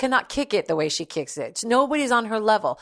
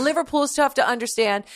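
normal talking voice

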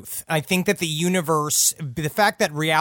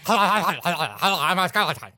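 skeleton voice. Pretend. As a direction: play a giggly cartoon skeleton. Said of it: "would you do your skeleton impression".

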